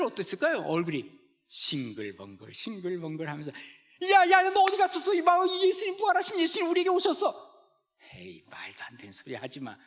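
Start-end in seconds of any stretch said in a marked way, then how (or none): 4.1-7.3 high, loud, fast bursting news; excited disciples. Retold or imitated. Disciples to Thomas about the risen Jesus, excited shouting. 8.1-9.8 whispered, dismissive scoff. Retold or imitated. Thomas to the disciples, whispered scoffing disbelief.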